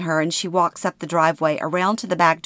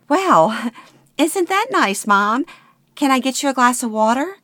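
much higher, laughing mock-sweet tone; forced cheer; 8 semitones higher than usual. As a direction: hide the panic behind sweetness. Forced cheer toward mother.

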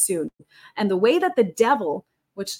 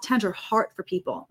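usual speaking voice

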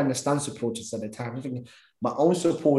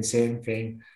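ordinary talk, nothing marked

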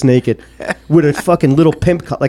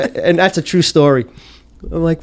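ordinary delivery

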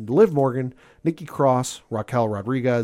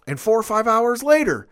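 higher and higher, mock intense Brock Lesnar; lightly voiced mock Brock promo, rising; imitating Brock building up; 6 semitones higher than usual. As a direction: wind up each repeat of the line a little higher, half-hammed.

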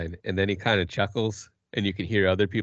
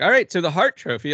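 raised, louder presenter voice; imitated presenter; 9 semitones higher, 6 dB louder than usual. Mimicking presenters moving on to the next trophy, loud and high. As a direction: announce the next award briskly. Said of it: "and then they're like".

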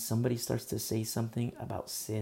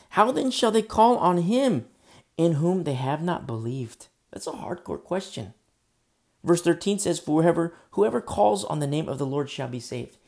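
loud ringing scripture read; preaching at full force; 4 semitones higher, 8 dB louder than usual. Acted. Preacher to congregation, booming scripture reading. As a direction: proclaim the verse to the hall.

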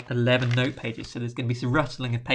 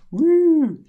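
mock excited whoop; 10 dB louder than usual. Mocking radio comet excitement.

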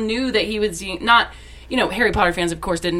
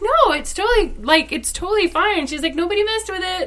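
much higher, sweet, breezy reassurance; lightly voiced Summer Glau; 10 semitones higher than usual. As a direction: brush off the apology kindly.

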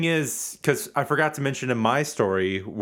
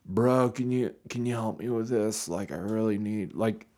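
put-on softer, slurring drunk request; mock drunk plea; 4 semitones lower, 5 dB quieter than usual. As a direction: beg like someone wasted. Imitating slurring drunk self.

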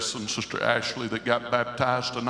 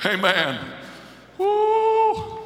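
preaching at full force; 13 semitones higher, 5 dB louder than usual. Real, not acted. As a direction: holler it out in full joy. Preacher at the climax, shouting.

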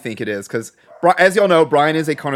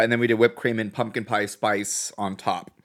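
ordinary talk, nothing marked

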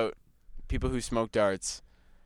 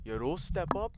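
scolding mother; 5 semitones higher, 5 dB quieter, slower than usual. Retold or imitated. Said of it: "She's just like".

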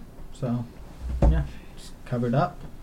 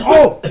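coughing up water; 16 dB louder than usual. Real, not acted. Drink went down wrong pipe.

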